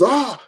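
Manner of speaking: high sharp cry of outrage; a mock cry of disgust as papers fly; exasperated outcry. Mother throws papers in anger.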